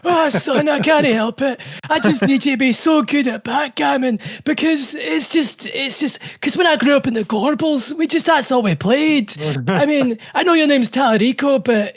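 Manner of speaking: put-on high, thick Scottish brogue of an old dad; hammy old Glaswegian father begging his son; Scottish old man voice. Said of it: "My old man voice is very Scottish".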